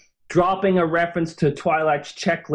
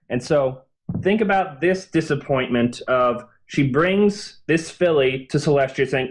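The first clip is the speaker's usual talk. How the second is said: normal talking voice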